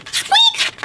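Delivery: very high little mouse squeak; tiny squeak. A narrator's reading. Storyteller makes the tiny noise Owl hears, high squeak. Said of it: "no louder than a whisper".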